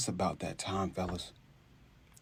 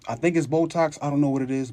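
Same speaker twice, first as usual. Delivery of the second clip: normal talking voice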